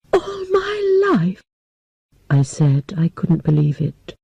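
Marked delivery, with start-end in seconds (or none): 0.1-1.4 high, slow, crying disbelief; shocked cry. Acted. Girl told she stays forever, crying out in disbelief.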